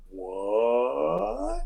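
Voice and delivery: long drawn-out rising question; acted, drawn-out bewilderment; stunned crowd reaction. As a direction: stretch it out, sliding slowly upward in disbelief. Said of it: "turns around and is like".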